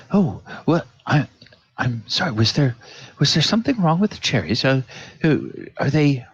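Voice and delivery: a touch of a nervous, apologetic waiter; lightly flustered servant; flustered server. Acted. Server worried something was wrong with the cherries.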